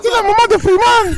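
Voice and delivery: hammed-up Ivorian mom calling school; accent ivoirien exagéré, voix aiguë; mock Ivorian accent mother. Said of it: "un accent ivoirien".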